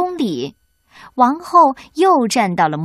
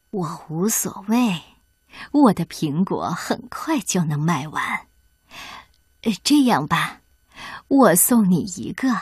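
lightly voiced farm woman; 4 semitones lower than usual. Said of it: "农妇回答".

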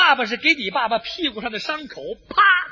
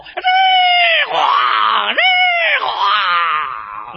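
imitated howitzer fire; 3 dB louder, much slower than usual. Father firing howitzers at the other father, imitated blasts. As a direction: mimic shells whining in and exploding. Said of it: "一阵榴弹炮".

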